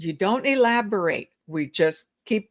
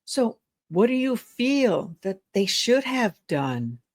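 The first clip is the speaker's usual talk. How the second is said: higher, hushed question leaning on feel and done; soft, stressed question; 4 semitones higher than usual. Shown as a demonstration. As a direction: lean into feel, sink on done. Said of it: "Now we're gonna whisper".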